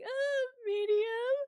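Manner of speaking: high, slow, timid squeak; nervous answer. Retold or imitated. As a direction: answer meekly, scared of the slap. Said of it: "I was very nervous".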